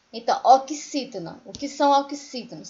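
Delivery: normal talking voice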